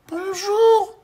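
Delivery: very high, loud little greeting; tiny little voice. Acted. Tiny man calls up to the postman, very high voice. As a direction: pipe up from the ground. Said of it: "une petite voix".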